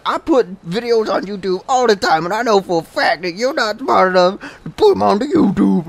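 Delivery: put-on high-pitched boastful kid parody; mock a bragging young gamer; imitated teenage kid. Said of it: "the local thirteen kid".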